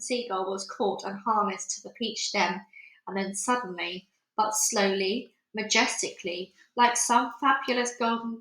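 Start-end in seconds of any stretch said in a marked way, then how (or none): none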